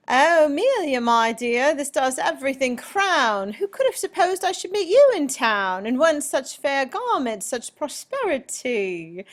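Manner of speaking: high, loud, gushing British greeting; British accent. Acted. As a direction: exclaim it in a put-on British accent. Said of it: "read this poem with a British accent".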